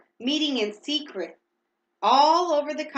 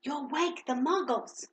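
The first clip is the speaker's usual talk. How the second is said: hissed warning; 4 semitones higher, 5 dB quieter than usual. A narrator's reading. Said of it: "hissed Professor McGonagall".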